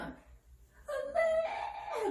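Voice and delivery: very high, long-held "no"; demonstrated scolding cry. Shown as a demonstration. Consultant showing how she calls out to stop the child, high drawn-out cry. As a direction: stretch the last syllable out high. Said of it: "내가 이렇게 소리치면".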